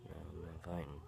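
whispered, very soft refusal; imitating a barely audible, meek refusal; real low. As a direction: back out under your breath in a whisper. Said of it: "he said it, like, real low".

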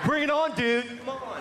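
high, hammed-up tough guy taunt; mock a Tae Bo fighter squaring up; laughing fake bravado.

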